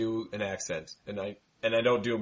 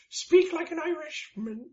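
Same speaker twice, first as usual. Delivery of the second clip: squeaky leprechaun pitch, slowed right down; high leprechaun voice; slower than usual. Pretend. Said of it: "why did your voice go up like eight octaves".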